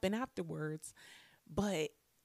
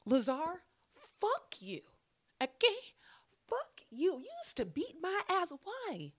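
angry rant at bully; 9 semitones higher than usual. Retold or imitated. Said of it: "I was just like".

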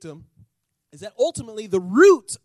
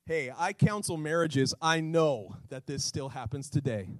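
normal talking voice

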